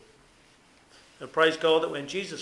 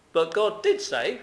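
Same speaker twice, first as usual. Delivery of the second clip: "God did say" pushed up high and loud; firmly insisting that God did say; emphatic; 5 semitones higher, 5 dB louder than usual. Retold or imitated. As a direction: land "did" hard, higher and louder. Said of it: "quite emphatic".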